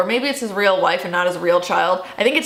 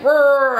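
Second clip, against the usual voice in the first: a hammed-up roar, louder and higher; mock roar; 7 semitones higher, 5 dB louder than usual. Okonkwo answering his village with a mock roar, louder and higher. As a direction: roar like an angry beast, half joking.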